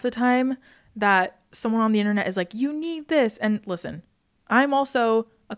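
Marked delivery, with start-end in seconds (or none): 2.5-3.4 higher, insistent mock sales pitch; imitating pushy influencer. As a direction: push it like an online promoter. Imitates pushy internet product promoters.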